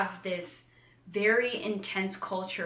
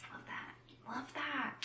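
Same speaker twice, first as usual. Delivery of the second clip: breathy whispered delight; 6 semitones higher, 12 dB quieter, slower than usual. Real, not acted. Savouring the mystery line in a whisper. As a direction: whisper it like a secret thrill.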